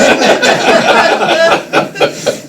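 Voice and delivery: very high gleeful cackle; high mischievous cackle. Retold or imitated. Shiva in the child laughing at him, high cackle. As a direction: cackle like a trickster god. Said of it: "appeared in this child's body and just went".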